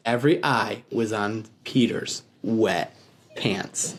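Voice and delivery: usual speaking voice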